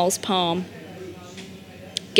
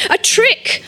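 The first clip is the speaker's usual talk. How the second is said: crying out; 13 semitones higher than usual. A narrator's reading. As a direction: cry foul from the crowd, voice leaping up. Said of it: "one man cried".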